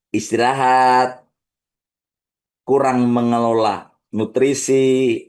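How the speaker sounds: slow, low penitent prayer; unhurried repentant supplication; guided confession prayer. Acted. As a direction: pray it slowly so they can follow. Leads confession prayer to repeat.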